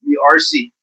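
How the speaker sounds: "arsey" with an added ee, pitched high; mispronounced arsey. Acted. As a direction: squeak out "arsey" with an ee ending. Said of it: "Or do you say arsey?".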